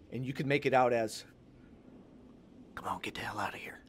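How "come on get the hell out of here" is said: quick, soft whispered mumble; under-breath mumble. Acted. Game master voices someone overheard in the cellar, whispered mumble. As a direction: mutter it fast under your breath. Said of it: "a very small mumble of a word".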